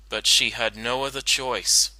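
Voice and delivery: ordinary delivery, nothing marked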